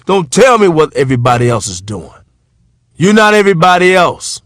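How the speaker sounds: lightly voiced grandmother laying down the law; a hint of a scolding grandmother building to a firm cry; animated grandmother. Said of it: "she was so animated about it".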